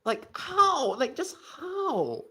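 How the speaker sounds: high incredulous how, then slow drawn-out just how; exasperated. Real, not acted. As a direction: plead in comic disbelief. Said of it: "exasperated at pronunciation".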